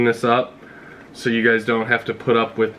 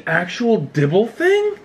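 surprised; 10 semitones higher than usual. Real, not acted. Missing item in the box.

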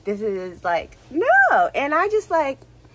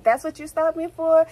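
incredulous question; 6 semitones higher than usual. Retold or imitated. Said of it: "I was like".

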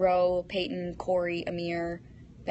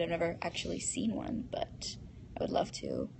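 whisper; 2 semitones lower, 8 dB quieter than usual. Real, not acted. Confiding aside about dolphins.

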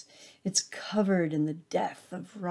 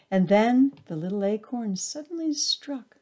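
usual speaking voice

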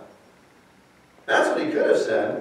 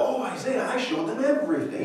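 high, fast gushing boast; proud; 9 semitones higher, 4 dB quieter than usual. Acted. Hezekiah boasting to the prophet, proud and gushing. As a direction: brag like it's an honor. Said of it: "He's proud".

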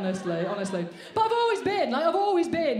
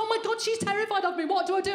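mock panic; 6 semitones higher than usual. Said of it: "I panicked".

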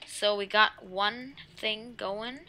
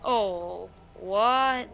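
jump scared; 6 dB louder than usual. Real, not acted. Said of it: "That scared me".

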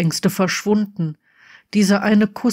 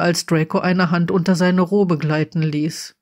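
ordinary delivery, nothing marked

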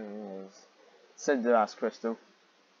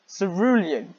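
exasperated exclaim; 7 semitones higher, 7 dB louder, slower than usual. Real, not acted. Mocking repeated word.

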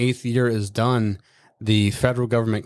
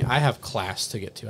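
ordinary talk, nothing marked

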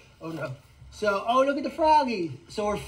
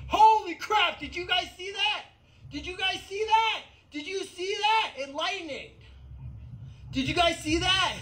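much higher, thrilled outburst; excited about lightning; 9 semitones higher than usual. Real, not acted. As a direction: burst out at the sudden flash. Lightning flash seen live.

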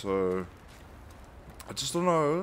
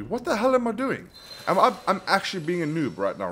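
high-pitched, fast self-scolding; can't believe your own mistake, pitch jumps up; exasperated disbelief; 9 semitones higher, faster than usual. Real, not acted. Gamer exasperated at own play.

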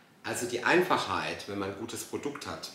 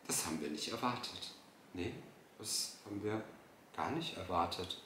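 quiet, halting mock excuse; mocking echo; 7 dB quieter than usual. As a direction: parrot their excuse softly, with pauses. Echoing the clip's excuse mockingly. (spöttisch leise nachgeäfft)

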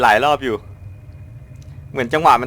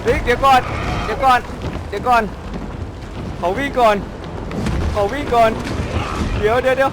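panicked pleading; 8 semitones higher than usual. Real, not acted. Begging the monster to wait.

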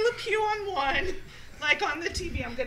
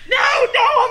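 shouted protest; 6 semitones higher, 9 dB louder than usual. Retold or imitated. Retold drunk denial.

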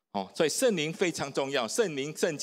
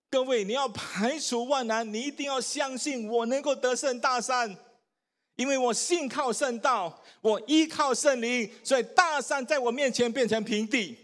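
high, ringing exhortation; urgent preacher's call to faith; preaching at full force; 6 semitones higher than usual. Acted. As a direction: rouse the congregation to believe.

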